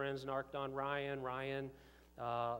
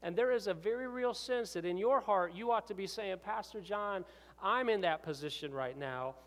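higher and louder, pleading with the crowd; fervent preaching; 7 semitones higher, 5 dB louder than usual. Acted. Pastor urging the congregation to repent, preaching loudly.

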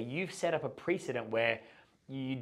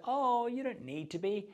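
put-on high-pitched girl's coo of reassurance; reassuring girl; 11 semitones higher than usual. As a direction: imitate a girl gently reassuring him, voice lifted high. Voices girl reassuring nervous man.

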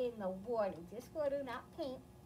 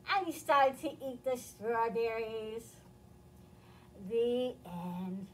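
lively storybook reading with voiced characters; reading to children; 4 dB louder than usual. A narrator's reading. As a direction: read it to little kids, expressive. Reads picture book to children.

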